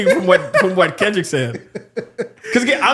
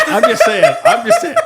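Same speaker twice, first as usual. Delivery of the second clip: laughing protest; 7 dB louder than usual. Real, not acted. Laughing protest after being teased.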